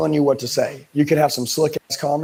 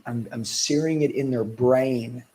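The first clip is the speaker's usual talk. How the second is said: slow and deliberate; slow emphasis; 2 semitones lower, slower than usual. Shown as a demonstration. Coach slowing to drive a point into trainees, deliberate. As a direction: drag it out to drive it home. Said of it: "what am I doing".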